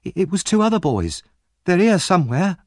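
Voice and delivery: put-on high, stammered boy's protest; pleading. A narrator's reading. Oliver blaming two other boys, pleading. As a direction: insist anxiously, pitched high. Accused boy pleads innocence.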